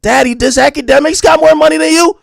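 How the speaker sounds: put-on high, loud kid's astonished question; mock child. Son asking dad about money, mock child. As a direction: whine it up like an incredulous son. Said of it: "his son came to him and said".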